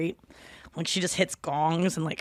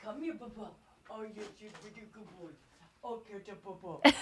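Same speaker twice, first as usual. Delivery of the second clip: much softer, hushed pet talk; cooing to a pet; 2 semitones higher, 14 dB quieter than usual. Real, not acted. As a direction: coo softly to coax the little pet over. Calling her robot vacuum like a pet.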